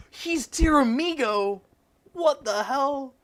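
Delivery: high, loud laughing disbelief; shocked. Real, not acted. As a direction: blurt it out in stunned delight. Shocked discovery while browsing cast.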